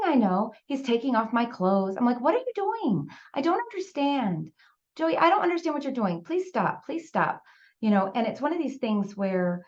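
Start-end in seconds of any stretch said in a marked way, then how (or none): 2.2-7.4 high, frightened pleading; pleading child. Retold or imitated. Little girl begging the man to stop, frightened. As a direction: beg him to stop, confused.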